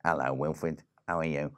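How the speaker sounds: low, soft crab voice; low-voiced little crab character; land crab Reggie. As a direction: greet a pal in a deep, quiet voice. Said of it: "they were talking to each other".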